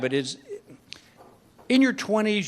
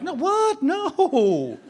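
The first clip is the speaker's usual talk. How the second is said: high yelp of innocent surprise; startled denial; 9 semitones higher, much slower than usual. Acted. Husband caught by the wife's question, startled denial. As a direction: squeak out a panicked no.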